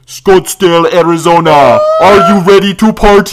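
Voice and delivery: loud, high crowd-rousing shout in a mock European accent; European DJ hype. European DJ to a concert crowd, loud hyped-up shout. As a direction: imitate a festival DJ hollering at the crowd. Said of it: "European dudes are like".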